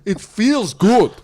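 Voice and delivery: put-on slow, high, loud Russian accent. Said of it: "the Russian accent".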